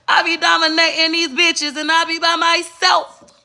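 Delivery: high, loud bragging line; high brag. Acted. Boasting to viewers in a high, loud voice. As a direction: crow it out high and proud.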